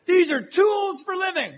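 high, slow, loud proclamation; mock preacher booming; booming preacher shout. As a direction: boom it out like a zealous speaker. Speaker booming from the podium.

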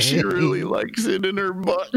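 very high, strained through real giggles; helpless with laughter. Real, not acted. Poet struggling through own rude rhyme, helpless with laughter. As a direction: squeal the line out while cracking up. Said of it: "You can't read it without laughing".

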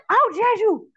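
high, loud mock outrage; pissed-off guy, imitated. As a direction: imitate him blowing up in a high-pitched shout. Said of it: "This guy was so pissed".

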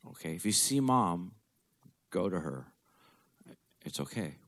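soft, hushed reassurance; tender goodbye. Retold or imitated. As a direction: gently release your dying father. Telling dying father let go.

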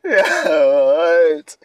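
high, loud disbelieving cry; can't believe what you just read; shocked yell. Real, not acted. Reacting to strange quiz answer.

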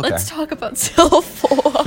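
real laugh breaking into the words; laughing tease. Real, not acted. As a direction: tease through a burst of laughter. Teasing co-host about self-love, laughing.

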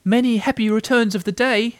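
high, eager little voice; small creature's high-pitched birthday greeting; Piglet. A narrator's reading. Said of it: "said Piglet again".

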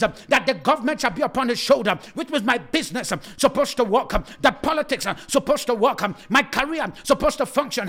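ordinary delivery

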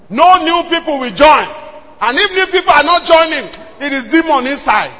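fiery preaching; full-force preaching. Acted. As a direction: preach it hard.

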